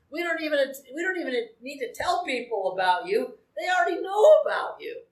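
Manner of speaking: emotion cracking through, rising high; choked up, pushing the words out fast; voice breaking. Real, not acted. Preacher to his church, choking up with emotion.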